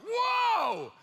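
high, loud shocked exclamation; astonished whoa. Retold or imitated. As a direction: gasp out whoa in amazement. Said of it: "my hair on my arm's like".

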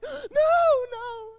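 high wailing no no; horrified crowd no. Retold or imitated. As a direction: cry out like a shocked audience. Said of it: "they're all like".

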